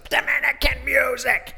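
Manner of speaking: the fired-up grandma chanting at the driver, shrieking high; old lady shouting. Retold or imitated. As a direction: imitate a tiny smoker grandma yelling her demand. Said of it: "she stands up and goes".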